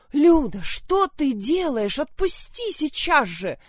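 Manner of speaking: high, loud shriek, slow on the question; shrieking mother. Acted. Mother shrieks at Lyudmila to release her sister. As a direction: scream at her daughter to let go. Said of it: "Завизжала".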